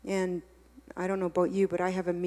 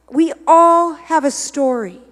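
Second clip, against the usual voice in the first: louder and higher, ringing out on all; preached declaration; 7 semitones higher, 15 dB louder than usual. Acted. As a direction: proclaim it to the whole room. Preaching climax.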